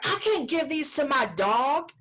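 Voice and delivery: hammed-up yelling diner; mock yelling customer. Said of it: "she started yelling at me".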